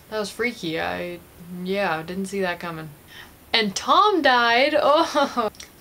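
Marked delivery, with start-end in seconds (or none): 3.5-5.5 high, loud, drawn-out lament; mock grief. Vlogger announces favourite actor's death, hammed-up mock grief. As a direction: wail it slowly in playful dismay.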